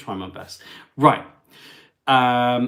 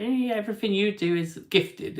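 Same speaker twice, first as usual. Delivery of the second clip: high-pitched mocking quote; hammed-up petty accusation; mimicking critics; 9 semitones higher than usual. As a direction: mimic a carping critic in a higher voice.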